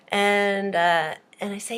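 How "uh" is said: ordinary delivery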